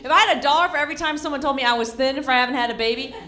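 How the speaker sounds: ordinary talk, nothing marked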